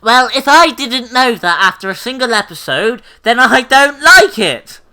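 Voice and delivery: high, loud mocking friend voice; impression of Alex. Teasing a friend, a high loud impression of him. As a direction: ham up your friend's fussy complaint. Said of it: "That was my best impression of you".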